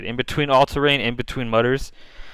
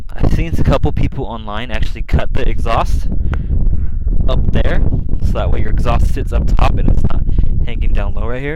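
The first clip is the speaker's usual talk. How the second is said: shouting over wind; 10 dB louder than usual. Real, not acted. Stepped outside into the wind.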